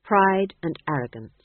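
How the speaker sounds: ordinary delivery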